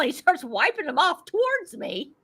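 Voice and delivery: usual speaking voice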